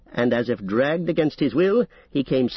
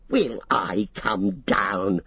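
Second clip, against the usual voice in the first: mocking; 4 semitones lower, much slower than usual. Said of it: "he mocked".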